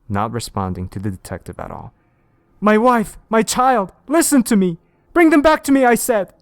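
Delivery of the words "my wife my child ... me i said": high, loud pleading shout; desperate yell. Acted. Detective begging the game for his family, loud and high. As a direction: scream at the game to return them.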